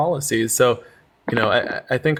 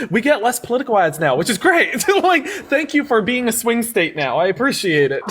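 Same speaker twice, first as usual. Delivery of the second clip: high, giggly thanks; laughing; 8 semitones higher than usual. Real, not acted. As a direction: laugh while you say it. Thanking Georgia, amused.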